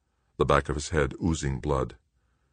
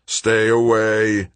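slow, louder growled warning; growling warning; 4 semitones higher, 5 dB louder, slower than usual. A narrator's reading. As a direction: growl it as a threat. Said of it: "Greg warned, growling".

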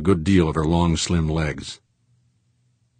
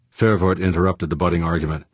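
plain reading voice

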